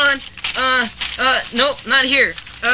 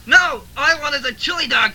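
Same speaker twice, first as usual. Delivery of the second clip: usual speaking voice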